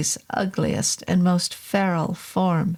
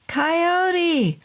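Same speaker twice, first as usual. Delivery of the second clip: long high shout of the name; high ringing call; 10 semitones higher than usual. Acted. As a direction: shout it into the fog. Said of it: "I shouted".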